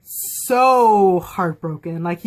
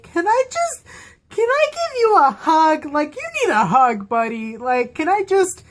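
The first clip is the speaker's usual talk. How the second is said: much higher pleading, squeaky with feeling; aching for a hug; 6 semitones higher than usual. Retold or imitated. Fan longing to hug heartbroken Jace, pitched way up. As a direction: plead high like you want to hug him.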